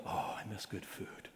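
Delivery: breathy, hushed sigh of a homesick man; hushed wistful quote. Retold or imitated. Uber driver quoted missing home food, hushed and wistful. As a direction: retell the driver's longing in a near-whisper.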